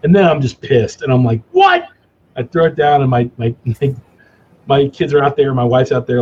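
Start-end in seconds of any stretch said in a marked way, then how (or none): 1.5-1.9 sudden high yelp of outrage; angry what. Retold or imitated. Dad snaps at his cracking cigar, shrill outraged yelp. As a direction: shriek the word in disbelief.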